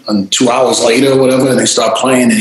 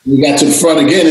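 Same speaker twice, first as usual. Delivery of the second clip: usual speaking voice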